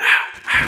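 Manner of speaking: হা whispered with an open mouth, friction from the throat; হা, হা breathed out as a raspy throat sound; breathy throat ha. Shown as a demonstration. Teacher demonstrating the হা sound with an open mouth. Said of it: "একটু হা করে একটু শব্দ হবে".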